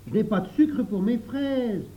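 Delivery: put-on high-pitched boyish complaint; lightly acted little boy; child's complaint. Jacques complains to the others.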